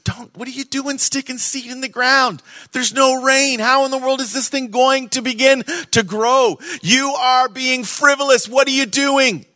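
high, incredulous scoffing crowd; mocking doubters. Onlookers scold Isaac for sowing in famine, high incredulous scoff. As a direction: play the scoffers jeering at the sower. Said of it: "many people were going".

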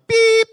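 high, loud electronic beep imitation; answering machine beep. Preacher imitates his old answering machine, high loud beep. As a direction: mimic a machine with one piercing tone.

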